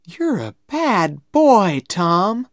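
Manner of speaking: put-on high, loud, drawn-out girlish scolding; playful scolding. A narrator's reading. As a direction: scold him playfully, stretching the words. Becky teasingly scolds Tom.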